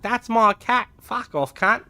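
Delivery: high, loud mock Australian accent; hammed-up Australian, starting high and loud; attempted Australian accent. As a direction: put on a bad Aussie accent for a retort. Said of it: "my attempt at an Australian accent".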